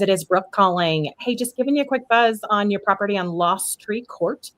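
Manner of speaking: normal talking voice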